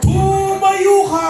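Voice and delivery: high, long-held shouted command; commanding faith healer. Acted. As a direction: belt it out as a stretched miracle cry. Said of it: "At sumigaw siya".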